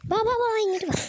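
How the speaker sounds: high coaxing call to a pet; sing-song call to bring the dog over. Real, not acted. Vlogger calling her dog to come over, in a high coaxing voice.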